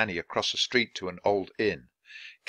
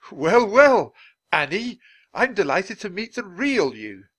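high, loud, expansive gentleman; delighted Dickens; 10 semitones higher, 8 dB louder than usual. Acted. Dickens delighted to meet the real Annie, expansive. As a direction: greet her with big delighted emphasis.

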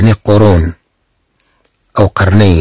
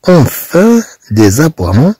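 very slow, higher read-aloud; slow expressive reading; 7 semitones higher, much slower than usual. Shown as a demonstration. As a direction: stretch out enfin slowly and higher. Teacher reads French text expressively. (قراءة بطيئة جدا معبرة)

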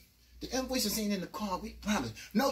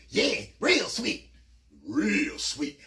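slow, louder leering echo; creepy sidekick; 4 dB louder, much slower than usual. Retold or imitated. Lil Leak echoing Cannon. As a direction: drawl it back mockingly. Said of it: "Lil Leak said".

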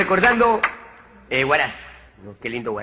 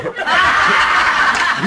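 risa aguda sin voz; reírse soplando aire; risa ahogada; 11 semitones higher, 6 dB louder than usual. Real, not acted. (risa aguda y soplada)

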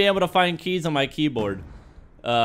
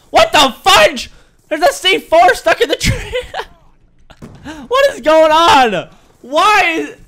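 high, loud stunned outburst; loud, high shocked yelling; disbelief; 13 semitones higher, 10 dB louder, slower than usual. Real, not acted. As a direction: shout it in total disbelief. C4 stuck in tree, disbelief.

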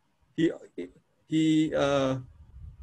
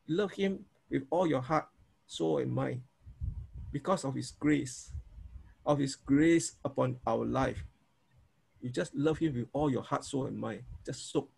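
softer, hushed soaking in God's love; soaking prayer; 4 semitones lower, 7 dB quieter than usual. Shown as a demonstration. Pastor leading students into soaking prayer, softer and hushed. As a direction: let the words settle quietly, soaking. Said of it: "you soak".

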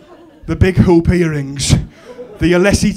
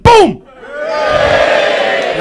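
explosive high yell; shouted punch impact; 13 semitones higher, 5 dB louder than usual. Retold or imitated. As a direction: boom it out like a landed punch. Acting out daughter's punch.

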